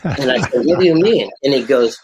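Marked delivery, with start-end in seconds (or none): 0.7-1.3 higher, louder puzzled ask; surprised question. Retold or imitated. Greg, stunned by the billionaire, asking what he means. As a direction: ask it caught off guard, voice jumping up. Retelling his confused reply.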